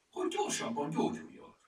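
high-pitched, huffy retort; offended. A narrator's reading. Kajtánka defends using too much ointment, offended. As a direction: answer back, hurt at being blamed. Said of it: "felelte sértődötten Kajtánka".

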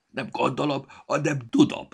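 loud, high, stuffed-nose retort; emphatic retort. A narrator's reading. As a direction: fire back with certainty through a blocked nose. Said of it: "vágta rá a manó".

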